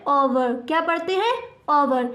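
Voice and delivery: ordinary delivery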